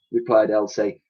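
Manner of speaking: plain reading voice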